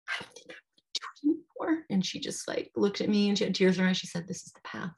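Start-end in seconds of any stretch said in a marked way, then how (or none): 0.1-1.8 hushed, high whisper; whispered confession. Retold or imitated. Woman in labour confiding in her friend, whispering. 4.3-4.9 very soft, near-whispered retelling; hushed reassurance. Retold or imitated. Friend with tears in her eyes answering, hushed. Tearful friend's reply, retold.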